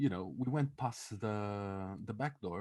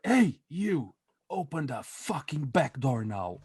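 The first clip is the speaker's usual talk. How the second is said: loud, high call, then slower forceful command; imitated aggressive order; 5 semitones higher, 8 dB louder, slower than usual. Friend ordering the restaurant man about, retold shouting. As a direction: holler it at someone across the room.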